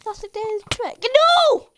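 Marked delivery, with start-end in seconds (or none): 1.0-1.7 high, loud cry of "no"; shouted protest. Real, not acted. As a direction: yell it out in sudden dismay. Player gets killed in game.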